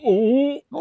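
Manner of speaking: high, loud mock "oh"; a hammed-up cry of someone dropping away; mock falling cry. Imitating Non trying to fly and falling, mock falling cry. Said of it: "Non tries to fly".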